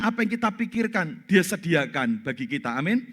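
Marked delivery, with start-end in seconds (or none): none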